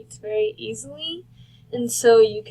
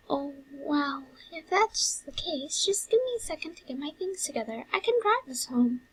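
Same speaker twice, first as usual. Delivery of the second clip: higher, eager-to-help character voice; concerned brother; 5 semitones higher, 6 dB quieter than usual. Acted. Brother offering Sans a ride home, high and concerned. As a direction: pitch it up as a helpful, worried brother.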